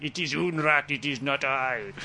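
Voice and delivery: hammed-up Polish accent, slowing on the last words; mock Polish accent. Adamski shifting blame to the FBI, mock Polish accent. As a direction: blame another man in comic Polish English, "Unrat" without H. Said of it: "he told the F B I".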